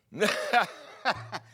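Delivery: loud, high burst of mock laughter; imitated uncontrolled laugh. Preacher imitating the evangelist unable to speak, big mock laugh. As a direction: cackle loudly as if overtaken by laughter. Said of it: "and he's just laughing".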